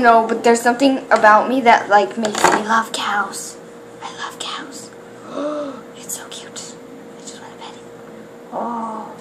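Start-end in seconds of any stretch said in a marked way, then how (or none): 4.0-9.1 soft hushed whisper; softer breathy-free whisper of delight; awed whisper. Real, not acted. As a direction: whisper it adoringly. Whispering love for cows.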